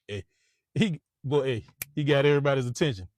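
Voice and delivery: ordinary delivery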